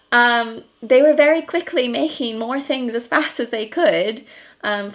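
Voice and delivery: ordinary delivery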